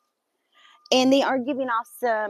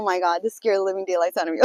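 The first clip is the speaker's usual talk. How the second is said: ordinary talk, nothing marked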